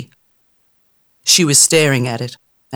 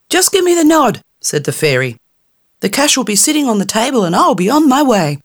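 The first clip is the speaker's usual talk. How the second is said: impatient fairy; 8 semitones higher than usual. Acted. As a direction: hurry her into the wish, tiny and brash. Fairy offering cash.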